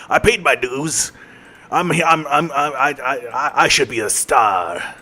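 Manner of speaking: slow, pleading actor's lament; milk it like a desperate wannabe star; hammed-up aspiring star. Wannabe actor pleading for his big break, hammy lament. Mock dramatic plea for stardom.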